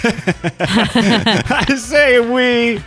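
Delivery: laughing through the words. Real, not acted. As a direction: crack up mid-sentence. Laughs at own slip.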